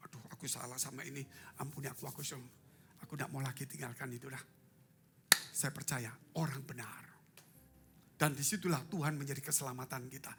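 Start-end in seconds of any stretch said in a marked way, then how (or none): 0.1-4.4 remorseful confession. Retold or imitated. Sinner confessing in prayer.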